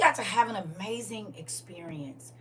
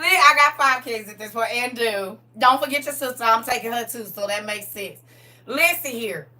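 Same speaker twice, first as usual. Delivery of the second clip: usual speaking voice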